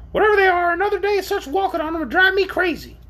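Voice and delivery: shrill, high creature wail; moaning. A narrator's reading. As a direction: wail in exasperation as the footsore orc. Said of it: "moaned the orc".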